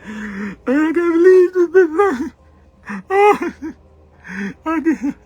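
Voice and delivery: very high, loud hammed-up wail; mock sobbing. Crying man in front of his homeboy, hammed-up wailing sob. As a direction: fake a big blubbering breakdown. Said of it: "cry around your homeboy".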